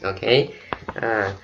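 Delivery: ordinary talk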